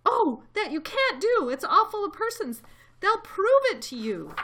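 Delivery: high, loud, frantic plea; alarmed protest. A narrator's reading. Elephant defending the tiny people, alarmed. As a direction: protest urgently at the top of your range. Horton horrified, protesting the boiling.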